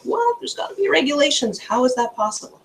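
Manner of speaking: high-pitched imitation of shocked people; imitated astonishment, starting very high and easing down; mock shock. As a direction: act out stunned disbelief, voice jumping up high. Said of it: "most people are totally shocked".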